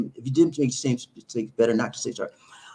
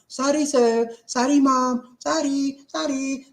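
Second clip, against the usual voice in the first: high, lilting imitation of Filipino apologies; sing-song Filipino accent; 10 semitones higher, slower than usual. Imitating Filipinos apologizing to sir or mom, sing-song Filipino accent. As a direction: chirp each "sorry" routinely in a Filipino accent. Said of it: "They like to sing in a song".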